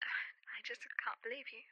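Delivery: sigh, then whisper it almost voiceless; hurt and defeated, barely breathing the words; exasperated whisper. Acted. Hurt friend gives up.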